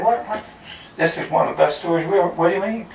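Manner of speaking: high-pitched, rushed disbelief; a judge who can't believe the refusal, high and hurried; mock astonished judge. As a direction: sputter it out fast and high like a stunned judge. Judge stunned by refused trophy.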